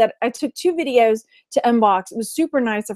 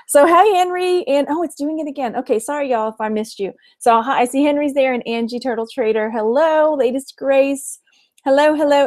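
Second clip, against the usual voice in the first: ordinary talk, nothing marked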